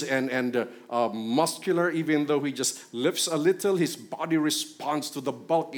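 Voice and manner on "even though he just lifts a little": normal talking voice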